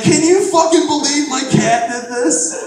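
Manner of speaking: high, loud, slow disbelief with a laugh; laughing astonished friend; amazed friend. Retold or imitated. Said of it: "And he's like".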